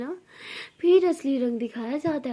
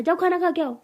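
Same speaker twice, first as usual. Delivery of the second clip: higher, louder impatient order; annoyed; 4 semitones higher, 5 dB louder than usual. Acted. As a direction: send her off with rising irritation. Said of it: "मुझे गुस्सा आ रहा है".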